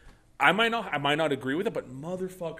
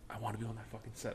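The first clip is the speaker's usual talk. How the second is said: much softer, under-the-breath quote; hushed inner thought; 3 semitones lower, 17 dB quieter, faster than usual. Retold or imitated. Crew hopeful quoting their reaction to hearing the pitch, hushed. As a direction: drop right down as if thinking it privately.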